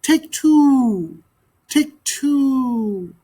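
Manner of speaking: high, falling coo; cooing dove. Acted. Silly dove cooing at the magpie. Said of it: "The dove cooed softly".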